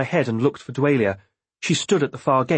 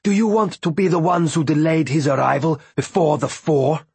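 raised, menacing demand; angry threat; 4 semitones higher, 3 dB louder than usual. A narrator's reading. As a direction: threaten the guards hard. Dwalia threatens the guards.

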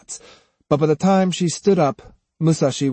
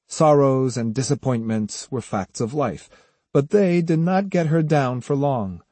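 usual speaking voice

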